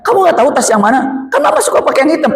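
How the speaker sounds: high, laughing exasperated scolding; mock irritated mother. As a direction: play the huffy mom. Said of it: "Jadi ngegas aja bawaannya". (ibu kesal sambil tertawa)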